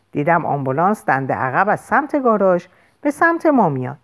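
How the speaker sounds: ordinary delivery, nothing marked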